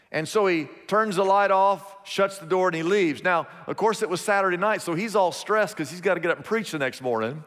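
ordinary talk, nothing marked

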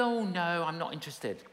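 lightly voiced dismissive shop lady. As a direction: brush him off, uninterested.

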